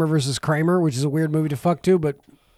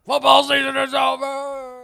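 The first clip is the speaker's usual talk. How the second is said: slow, louder, higher mock shout; gruff impression; 7 semitones higher, 5 dB louder, slower than usual. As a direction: declare it slowly and loudly. Hunter S. Thompson impression continues.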